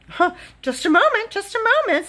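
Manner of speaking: high, quick, excited voice; urgent interruption. A narrator's reading. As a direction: rush to stop someone leaving. Dealer urgently stops departing painter.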